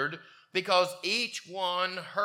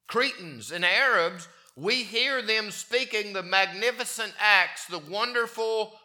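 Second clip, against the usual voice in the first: louder, rising proclamation of the verses; fervent scripture reading; 5 semitones higher, 7 dB louder than usual. Acted. Preacher proclaiming scripture to church, fervent and loud. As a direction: declaim the list of peoples with mounting awe.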